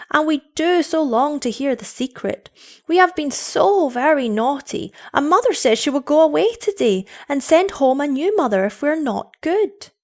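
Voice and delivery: put-on high, eager, pleading child voice; children's voice. Children begging the girl to reveal the secret, high and eager. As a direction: urge like anxious children.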